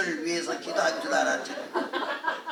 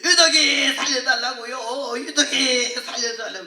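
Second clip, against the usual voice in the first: crying plea; 3 semitones higher, 5 dB louder than usual. Retold or imitated. Deaconess weeping and praying over the patient, begging through tears. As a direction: beg through tears for her life. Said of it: "얼마나 울면서 이래요".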